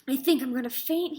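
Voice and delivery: ordinary delivery, nothing marked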